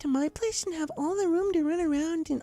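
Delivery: Fluttershy coaxing. A narrator's reading. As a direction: gently lure a hiding bunny out. Fluttershy coaxes a bunny.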